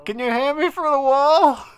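slow, loud call through a wall. Pretend. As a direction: call out slowly, high and loud. Calling to teammates through wall.

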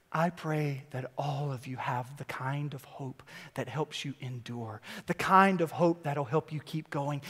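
soft, low, prayerful voice; hushed pastoral prayer; quiet prayer. Acted. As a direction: pray it gently over the listeners. Prays for the congregation.

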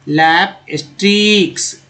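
लैप स्ट्रिक्स drawn out slowly, syllable by syllable; slow teacher model; slow careful demo. Shown as a demonstration. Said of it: "pronunciation demo of word".

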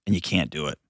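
ordinary talk, nothing marked

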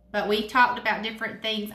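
quick hurried recap. Real, not acted. Pastor to the kids, hurrying back to the topic, fast. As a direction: rattle it off fast.

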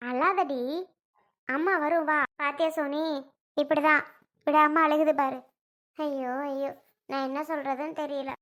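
low, soft, worried grandmother; soothing grandmother. Acted. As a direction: hush the child, then lament quietly. Plays grandmother soothing and lamenting. (தணிந்த குரலில் தேற்றும் அப்பத்தா)

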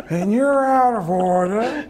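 very slow, building to a huge shout; Al Pacino. Acted. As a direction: stretch the words, then explode on the last ones. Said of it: "as Al Pacino".

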